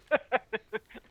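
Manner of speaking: short high burst of laughter; a quick amused laugh; light laugh. Real, not acted. Host laughs at own plug.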